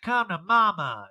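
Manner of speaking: hammed-up impression; Erica. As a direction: stretch it out brashly. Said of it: "She's like".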